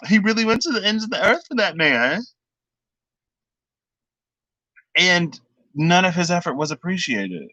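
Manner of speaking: very high, squeaky mock wail; mock-wailing lament. Fan lamenting SpongeBob's unappreciated effort, mock-wailing. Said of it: "the ugliest jumping out of me".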